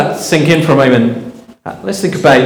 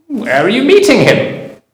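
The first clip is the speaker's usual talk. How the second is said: much higher, curious fox; 9 semitones higher than usual. Acted. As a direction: squeak it out as the nervous fox. Fox asks mouse in story.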